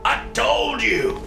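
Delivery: slow, high, breaking repeat; raw, dragged out. Acted. A father repeats himself to his son, breaking. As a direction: stretch it out as the voice cracks. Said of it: "voice raw with emotion".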